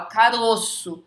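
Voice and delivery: caroço with a closed stressed o, "carôço". Shown as a demonstration. Teacher showing how we say the singular, closed o. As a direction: say caroço with the middle vowel closed. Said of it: "O Ozinho tá fechado".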